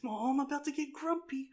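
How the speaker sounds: high, mild teacher voice; pleasant teacher. Retold or imitated. Pleasant teacher warning his class, mock grumpy. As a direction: imitate a too-nice teacher's gentle warning. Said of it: "he was so pleasant all the time".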